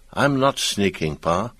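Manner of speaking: usual speaking voice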